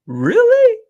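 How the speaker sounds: very high, loud mock-astonished squeal; incredulous kid. Imitating the kid hearing wife's Quran claim, incredulous squeal. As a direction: mimic a kid's shocked question, pitch leaping up. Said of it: "The kid says".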